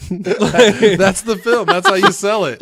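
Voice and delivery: high, slow laughing words. Real, not acted. Laughing at goofy Jim Carrey.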